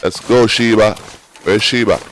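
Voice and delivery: loud, urgent call for Sheba. Real, not acted. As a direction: call the horse from across the field. Calling his horse in game.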